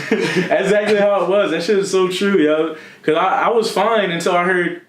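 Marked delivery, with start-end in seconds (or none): none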